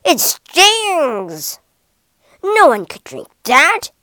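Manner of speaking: loud, stung outcry; hammed-up elephant complaint; pained protest. A narrator's reading. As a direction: cry out in pain and indignation. Elephant stung by fire water.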